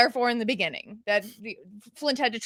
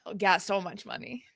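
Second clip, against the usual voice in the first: dry, deadpan mock agreement, lower and softer; sarcastic; 6 semitones lower, 7 dB quieter than usual. Co-host sarcastically agreeing they earn money, deadpan. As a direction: say it flat to mean the opposite. Joking about being paid podcasters.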